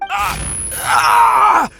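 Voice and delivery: sharp cry of pain, rising to a shout; sudden pained gasp turning into a shout. Acted. Sudden pain from ley line disturbance.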